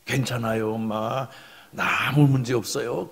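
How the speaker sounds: low, soft comforting of a mother; reassuring son. Acted. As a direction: reassure gently, voice dropped low. (엄마를 안심시키는 낮고 부드러운 목소리)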